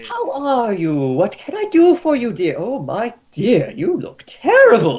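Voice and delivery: put-on high doting old healer voice; fussing matron. Healer cooing at a drunk patient at her door. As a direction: coo over the patient like a mother hen.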